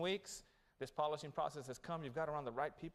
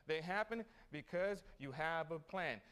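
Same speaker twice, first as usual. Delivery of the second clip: ordinary talk, nothing marked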